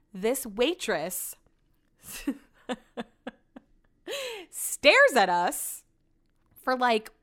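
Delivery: slow, stretched words with long pauses; halting, drawn-out storyteller delivery; dramatic slow telling. Real, not acted. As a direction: draw out each phrase to build comic suspense. Suspenseful telling of waitress's blank stare.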